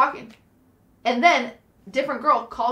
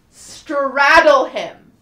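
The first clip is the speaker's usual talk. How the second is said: excited shout; 3 semitones higher, 9 dB louder, much slower than usual. Retold or imitated. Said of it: "Oh my God!".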